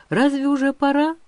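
put-on high, childishly capricious question; playfully whiny. A narrator's reading. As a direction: whine it in jest, like a pampered daughter. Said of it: "дурашливо капризно спросила она".

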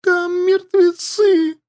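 very high, slow frightened wail. Acted. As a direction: wail in horror. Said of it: "причитала Янди".

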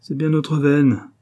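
a bandit's slow furious complaint; raging. A narrator's reading. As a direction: spit out the bad luck. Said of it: "ragea Delourmeau".

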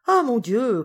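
impatient mistress losing patience; exasperated. Acted. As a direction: burst out impatiently at a slow servant. Impatient mistress scolds slow coachman.

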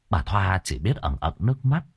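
plain reading voice

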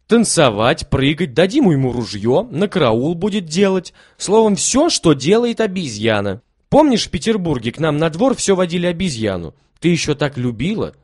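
put-on gleeful boy. A narrator's reading. Kolya explains the monkey game to Lilya, gleefully. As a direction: describe it with glee.

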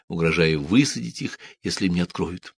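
plain reading voice

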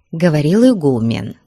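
usual speaking voice

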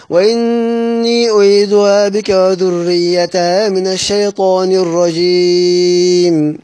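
slow, drawn-out chanted tajweed recitation, now in Abu Amr's reading with taqlil; measured Quranic chant, Abu Amr's reading. Shown as a demonstration. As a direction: recite it melodically, softening the vowel of أنثى toward taqlil. Teacher recites verse for Abu Amr.

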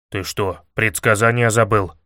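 low-pitched, mildly indignant rebuke. A narrator's reading. Илья reminding a friend of the prediction, low indignation. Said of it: "возмутился Илья".

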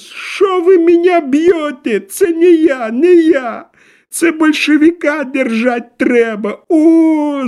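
high, loud, slow howl of protest; desperate thin wail. A narrator's reading. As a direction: wail loudly in a thin high voice while being beaten. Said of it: "завопил он". (отчаянный тонкий вопль)

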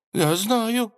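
high, nervous babble; babbling timidly. Acted. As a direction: stammer it out scared. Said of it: "пролепетал министр". (лепечет испуганно)

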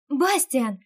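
calling out a name loudly; shout. A narrator's reading. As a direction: call out to him across the sky. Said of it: "крикнула я".

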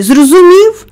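high, loud, rising challenge; angry demand. A narrator's reading. Mary demanding that the screaming boy understand, angry and loud. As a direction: fire the question at him, loud. Mary threatens to outscream Colin.

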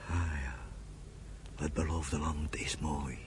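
whisper. Acted. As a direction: breathe it out in wonder. Quiet awe over the land. (fluisterend, vol ontzag)

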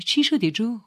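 high, loud startled question; acted surprised concern. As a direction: ask with sudden alarm. Sister startled by brother's amazed stare.

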